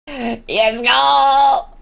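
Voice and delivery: ordinary delivery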